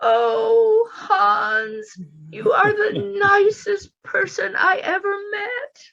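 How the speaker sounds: high, crying with happiness; tearful joy. Acted. Edna thanking Hans through happy tears, high and crying. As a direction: sob it out gratefully.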